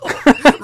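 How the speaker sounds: high, loud laughing burst; loud burst of laughter. Real, not acted. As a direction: crack up loudly. Laughing at own story.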